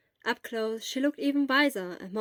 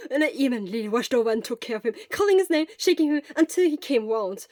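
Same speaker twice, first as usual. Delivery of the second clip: fast, loud, breathless rush of words; gushing; 3 semitones higher, 6 dB louder than usual. Acted. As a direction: let it all gush out at once. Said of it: "words gushed out of Hisashi".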